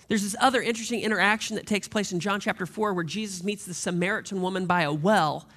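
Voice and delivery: usual speaking voice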